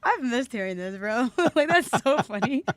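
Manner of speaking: talking through real laughter; laughing, amused. Real, not acted. Enjoying banter with an old co-host, amused laughing voice. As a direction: let the giggles break into the words.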